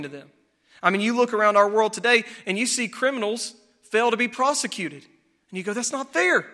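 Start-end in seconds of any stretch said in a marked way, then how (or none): 5.7-6.5 higher-pitched acted outrage; indignant protest. Churchgoer seeing criminals go unprosecuted, mock indignant. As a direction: protest it like an offended onlooker. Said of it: "It enrages us".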